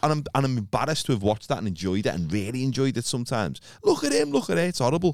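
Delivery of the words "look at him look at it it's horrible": high-pitched jeer at someone on telly; mock gawping. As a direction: point and gawp, pitched right up. Acts out laughing at TV guests.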